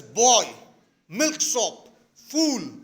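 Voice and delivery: loud insults, one word at a time; lost temper. Acted. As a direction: curse him in acted anger. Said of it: "Now he has lost his temper".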